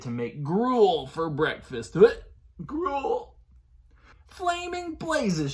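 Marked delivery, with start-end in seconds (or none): 2.7-3.4 disgusted. Acted. Disgusted exclamation at gruel. 4.3-5.5 high, slow alarmed cry. Acted. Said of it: "she cried".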